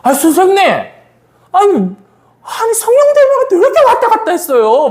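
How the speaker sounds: high-pitched exasperated protest; mock-indignant outburst, rising to a squeal; retold angry complaint. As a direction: act out confronting someone, voice climbing sharply. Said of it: "화가 탁 난 거야".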